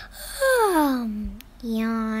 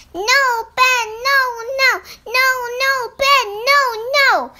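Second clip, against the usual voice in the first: high, loud chanting tantrum; 10 semitones higher, 7 dB louder, faster than usual. Acted. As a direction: whine it out like a toddler refusing bed. Tantrum while rolling.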